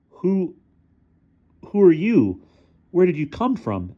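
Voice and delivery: high, halting scared demand; failed tough act. A narrator's reading. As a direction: try to sound tough and fail. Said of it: "failing to sound tough".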